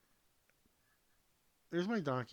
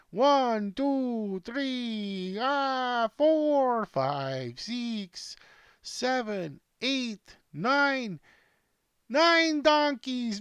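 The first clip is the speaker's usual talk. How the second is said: higher, louder, racing up the count; excited counting; 7 semitones higher, 4 dB louder, much slower than usual. Real, not acted. Counting racing donkeys for Dominic, excited high counting. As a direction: count them off in rising delight.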